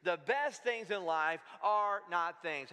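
slow, even, every word spaced for the crowd to follow; slow unison recital. Shown as a demonstration. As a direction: lead the room through it slowly. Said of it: "Say that with me on count of three".

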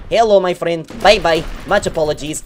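teasing hello then a light bye-bye; sweetly fake-polite to his victim; mock polite farewell. Gamer fake-politely greeting the enemy he kills.

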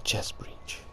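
whispered catchphrase. Real, not acted. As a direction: breathe it out without voice. Whispering catchphrase while hiding.

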